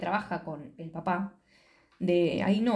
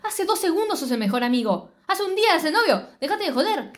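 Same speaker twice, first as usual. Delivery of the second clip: indignant; 7 semitones higher than usual. Real, not acted. Indignant rant at the character.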